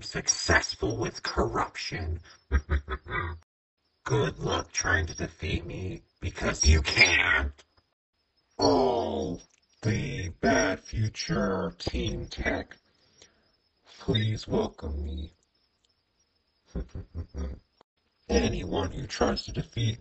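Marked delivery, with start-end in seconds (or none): 2.5-3.4 short, low evil chuckle; villain chuckle. Acted. Villain gloats over corruption. 16.7-17.5 soft, low villain chuckle; a quiet, low evil snicker; sinister chuckle. Acted. Villain chuckles after introducing himself.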